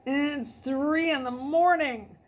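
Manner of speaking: sleepy woman hissing. A narrator's reading. Woman woken at 3am hisses at Luke on the phone, annoyed. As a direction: hiss it out, woken at three in the morning. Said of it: "the woman hissed".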